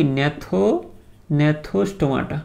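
gnathostomaটা stretched out very slowly; teacher sounding out gnathostomaটা; slow pronunciation. Shown as a demonstration. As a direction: model gnathostomaটা syllable by syllable. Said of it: "উচ্চারণ করতে করতেই ঠিক হবে".

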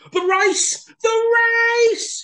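high, loud alarmed hare; panicked cry. Acted. Hare realizing she overslept, panicked cry. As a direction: wake in a panic. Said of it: "she cried".

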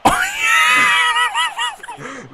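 high loud shrieking laugh. Real, not acted. Huge laugh.

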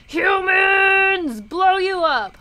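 high, loud shout, stretched on HUMANS; playful battle yell. Pretend. Gamer gorilla charging at humans, playful battle yell.

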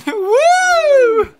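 long, high, loud "woo"; excited whoop. Real, not acted. Player celebrating the pitch, high whoop.

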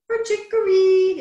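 high, loud chirped call; bird-call whistle. A narrator's reading. Whistle's other end calling like a bird, high chirp. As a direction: sing it out high, holding the end. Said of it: "it called".

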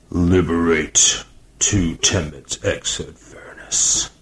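slow, strained, otherworldly monotone; possessed captain's strained slow voice; deep strained voice. A narrator's reading. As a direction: drag the words out like something speaking through him. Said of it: "in a deep, strained voice".

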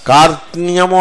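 కార్త్న్యము with the s sound missing; faulty కార్త్న్యము, r-t-n-y only; s dropped. Shown as a demonstration. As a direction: say కార్త్న్యము as kaart-nyamu, skipping s. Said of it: "సా పోయింది".

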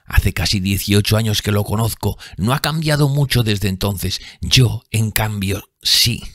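lightly voiced bitter drunk woman; bitter bar woman. A narrator's reading. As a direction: spit contempt at him.

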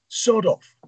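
high, scornful brush-off; scoffing. Acted. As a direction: scoff them away like a grumpy shopkeeper. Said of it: "Ron scoffs now".

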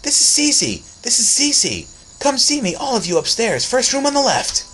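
put-on very high, loud shrill girl voice erupting; a girl's voice taking over the boy; Cece's voice bursting out. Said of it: "A voice burst out of his lips".

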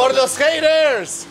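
slow, high, loud rallying cry; defiant toast. Real, not acted. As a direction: drawl it out as a big toast. Toast before eating dog food.

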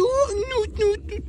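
high squeaky nut nut. Pretend. Silly high nonsense sounds.

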